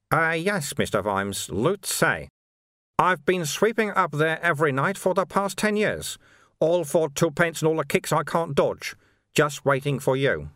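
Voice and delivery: high, lilting character voice; unruffled monk Sweeper. Acted. Sweeper explaining his ten-year wait to Vimes, unruffled and high.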